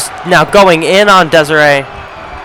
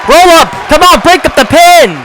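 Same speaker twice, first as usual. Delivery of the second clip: excited shout; 9 semitones higher than usual. Real, not acted. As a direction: yell for the pin break. Urgent near fall, calling out.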